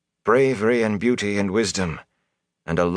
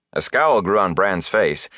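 usual speaking voice

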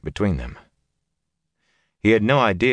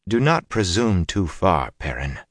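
ordinary delivery

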